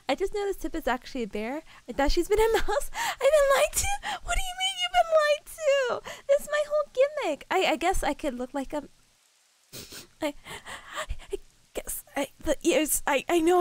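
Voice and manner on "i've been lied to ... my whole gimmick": high-pitched, exaggerated indignation; mock outrage. Streamer protests to friend about her bear gimmick, mock outraged. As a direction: squeal it in playful disbelief.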